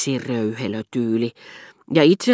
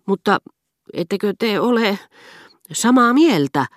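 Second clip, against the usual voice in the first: anxious, rising high and louder; worried question; 8 semitones higher, 7 dB louder than usual. A narrator's reading. Princess anxiously asking the duchess, worried and high. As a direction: ask it fretting, afraid of the answer. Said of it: "hän kysyi huolissaan".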